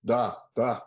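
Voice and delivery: put-on playful Russian accent on "da, da". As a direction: answer "da, da" in a mock Russian accent. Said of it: "It's a Russian accent".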